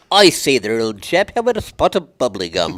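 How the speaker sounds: a hammed-up British gent; British accent. To the host, joking in a mock British accent. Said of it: "talk like she was British".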